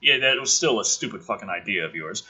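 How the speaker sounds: normal talking voice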